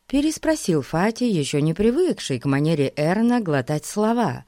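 ordinary delivery

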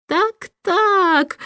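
smirking. A narrator's reading. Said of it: "усмехнулся лорд-канцлер".

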